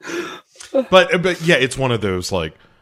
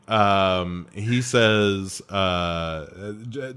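ordinary talk

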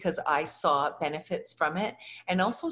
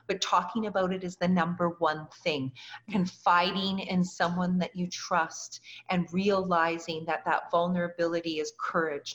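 ordinary delivery

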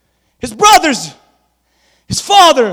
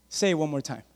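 quiet taunt; 8 semitones lower, 13 dB quieter than usual. Acted. David murmurs a dare at Goliath, softly. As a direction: dare him under your breath.

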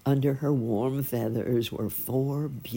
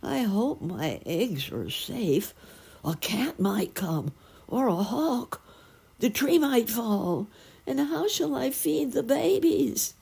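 fretting, climbing higher with each fear; worried sparrow; 10 semitones higher than usual. A narrator's reading. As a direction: let the worry build into a high-pitched fluster. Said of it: "he was looking very, very worried".

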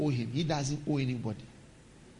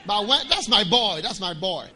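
loud, proud, higher father's voice; proud father claiming; 4 semitones higher, 7 dB louder than usual. Acted. Father claiming his grown child, loud and proud. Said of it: "he will come and claim you".

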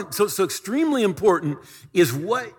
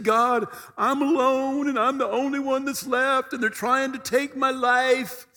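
a higher, pleading voice of a man who wants to give up; despairing prophet; 6 semitones higher than usual. Acted. Preacher voicing Elijah crying out to God, higher and pleading. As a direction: plead like a prophet at the end of his rope.

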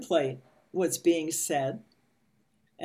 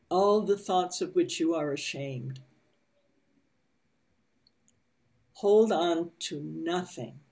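guided meditation reading. A narrator's reading. Reads meditation passage slowly.

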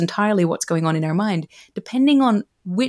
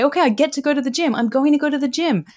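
higher, brighter, a bit quicker; upbeat reframe; 6 semitones higher than usual. Retold or imitated. Speaker reframing her gym chore, now eager and upbeat. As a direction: lift the voice to sound eager about it. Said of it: "I would just shift it and say".

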